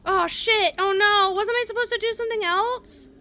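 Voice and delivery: loud, high sudden panic; alarmed. Real, not acted. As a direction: realize the mistake and panic.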